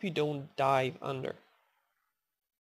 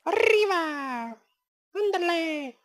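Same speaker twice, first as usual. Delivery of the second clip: high, loud rolled-r cries; loud, high, stretched Spanish exclamations; cheering on; 12 semitones higher, 7 dB louder, slower than usual. Pretend. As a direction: urge on with drawn-out fiesta yells.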